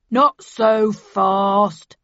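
slow, loud, menacing dealer voice; stern dealer. A narrator's reading. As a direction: stop them cold, very slowly. Dealer blocks their exit.

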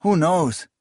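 unsure, nervously. A narrator's reading. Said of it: "the other nervously replied".

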